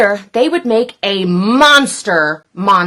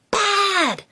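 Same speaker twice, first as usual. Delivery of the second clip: high, soft whisper; 10 semitones higher, 6 dB quieter than usual. Acted. Doom whispers outside the bakery, high and soft. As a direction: whisper through the window. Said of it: "whispered Doom".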